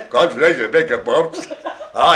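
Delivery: mock Australian, breaking into laughter; Australian accent. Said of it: "do an Australian accent".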